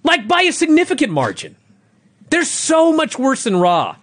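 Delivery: blowing his lid over these shows; angry rant. Real, not acted. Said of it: "Oh, too late".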